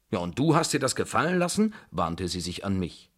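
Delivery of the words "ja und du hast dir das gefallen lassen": quick, high incredulous question; acted indignant challenge. Babuschka turning on the tutor, indignant and quick. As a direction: demand an answer in disbelief.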